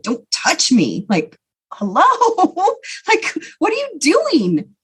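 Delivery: drawn-out, incredulous objection leaping high; indignant recoil, pitch swooping upward; acted indignant protest. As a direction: stretch the words out in mock disbelief. Wished-for protest at uncle, acted.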